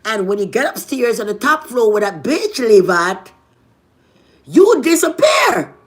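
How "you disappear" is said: louder, higher, slow exasperated cry; exasperated shout. Retold or imitated. Telling how her man vanished upstairs, exasperated shout. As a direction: stretch it out in disbelief.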